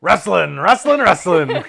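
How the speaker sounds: loud hype announcer chant; monster truck ad. Retold or imitated. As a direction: belt it like an arena ad. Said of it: "there's a reason that guy doesn't go".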